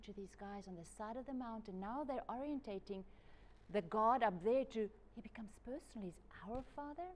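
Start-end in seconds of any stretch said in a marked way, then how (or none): 5.1-7.1 hushed whisper. Real, not acted. Preacher telling congregation God is personal, whispered. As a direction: lean in and breathe it. Intimate point in sermon.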